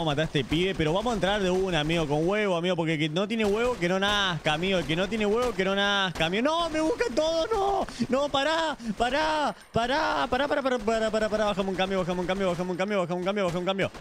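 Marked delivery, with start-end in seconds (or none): 6.4-11.4 high frantic pleading no and pará; real panic, voice way up; desperate high-pitched pará; panicked high cries. Real, not acted. Panicking in game.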